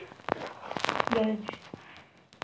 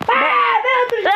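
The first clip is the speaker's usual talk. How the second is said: excited shout; 8 semitones higher than usual. Real, not acted. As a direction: shout it out eagerly. Claiming a seat, shouting.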